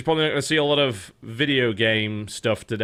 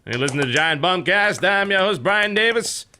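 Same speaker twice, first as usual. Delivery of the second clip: Brian Davis impression; 5 semitones higher than usual. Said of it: "my best giant bomb impression".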